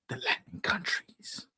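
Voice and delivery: slow, hushed whisper; whispered loud part. Pretend. Host slips viewers the banned part, whispered. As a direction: whisper it like a forbidden secret. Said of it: "I'm gonna whisper the loud part".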